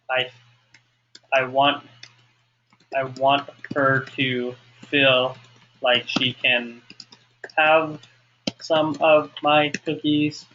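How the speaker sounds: slow, word groups split by pauses; dictating while writing. Shown as a demonstration. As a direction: speak only as fast as you can write. Composing worksheet answer while writing.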